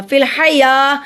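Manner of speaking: slow फिल हया with या held long; lengthened या. Shown as a demonstration. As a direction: stretch the final या of फिल हया. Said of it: "या के ऊपर खड़ी ज़बर है".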